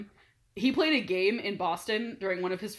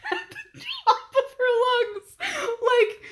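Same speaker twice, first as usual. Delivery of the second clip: laughing; 6 dB louder, slower than usual. Real, not acted. Laughing while describing girl.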